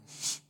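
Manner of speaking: long, sharp imitated shush; very French shush. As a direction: shush a noisy kid like an indignant French crowd. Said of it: "a very French shush".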